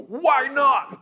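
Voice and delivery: loud, high outraged demand; hammed-up angry outburst; blowing up. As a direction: caller exploding in mock fury. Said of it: "You're just gonna blow up".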